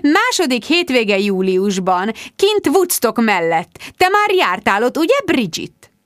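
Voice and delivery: high-pitched, emphatic gushing; pushy, gushing high voice; effusive insistence. A narrator's reading. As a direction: press the invitation in a high, eager voice. Rebecca insistently presses her invitation.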